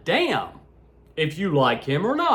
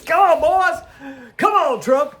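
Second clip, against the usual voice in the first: taunting call-out; 12 semitones higher, 5 dB louder than usual. Real, not acted. Taunting Trump, calling him out.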